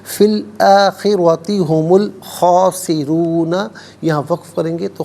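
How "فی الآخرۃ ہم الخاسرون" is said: فی الآخرۃ ہم الخاسرون slow, loud, heavy ra; heavy ra recitation. Shown as a demonstration. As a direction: chant the phrase with full ra. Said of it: "را کو پر کر کے پڑھیں گے".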